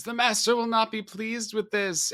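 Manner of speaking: high-pitched creature whine at normal volume; mock shrieking. Evil Ed runs off after being burned, mock shriek. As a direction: imitate a screeching minion without shouting. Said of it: "shrieks and screams and says".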